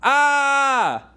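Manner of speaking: long, high scream of pain; Jerry Seinfeld. Acted. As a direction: scream loud and sustained, as if your eyes are gouged out. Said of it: "here's an impression of Jerry Seinfeld".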